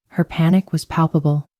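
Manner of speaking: ordinary delivery, nothing marked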